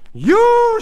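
very high, loud accusing scream. A narrator's reading. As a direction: explode at him in one shrieked word. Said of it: "she screamed".

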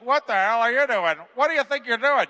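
loud, high-pitched heckling; jeering mockery. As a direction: imitate drunken tourists making fun of someone. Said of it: "totally making fun of her".

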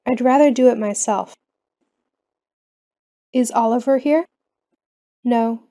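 usual speaking voice

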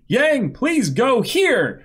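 high, drawn-out plea; pleading in a slow, high voice; mock plea. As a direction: stretch out a high-pitched entreaty. Acted plea to Yang.

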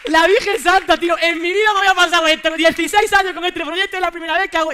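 loud stunned exclamation to the audience; astonished outburst. Real, not acted. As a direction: blurt it out in real amazement. Astonished after kids walk out.

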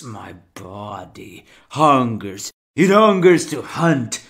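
ordinary talk, nothing marked

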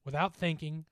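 usual speaking voice

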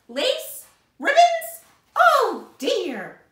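high, loud, disbelieving exclamations; shocked outcry. A narrator's reading. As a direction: cry out each discovery in mounting dismay. Missus Rogers finds lace in icebox.

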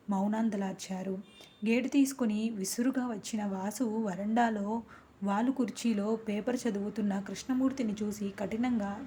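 usual speaking voice

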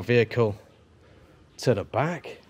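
ordinary delivery

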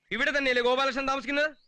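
usual speaking voice

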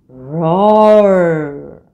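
loud roaring tiger. Acted. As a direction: roar out like a big cat. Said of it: "I see a tiger roaring".

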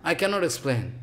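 ordinary talk, nothing marked

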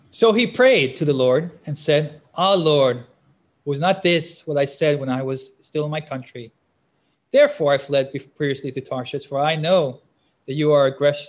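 loud, declaimed Bible verse; scripture reading. Real, not acted. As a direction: proclaim the prayer with strong emphasis. Reads scripture aloud to listeners.

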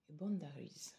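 boundaries said in English with an English accent; soft English accent on boundaries. Real, not acted. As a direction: read the English card name boundaries with an English accent. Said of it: "Désolée pour l'accent anglais".

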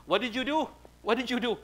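high, loud retold reproach; accusing. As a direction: act out confronting her through a curtain. Umar confronts Aisha through the curtain.

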